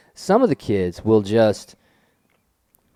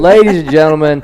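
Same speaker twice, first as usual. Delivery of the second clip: louder showman intro; announcer voice; 4 semitones higher, 9 dB louder than usual. Pretend. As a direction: call it out to the crowd.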